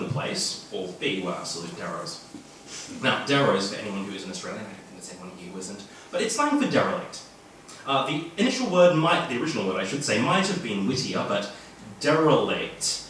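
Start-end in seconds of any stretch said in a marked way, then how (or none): none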